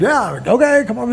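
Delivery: imitating a clumsy, awkward okay; high, hesitant mock reluctance. New guy pulled into a hug circle, awkward. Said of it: "Came in with the awkward".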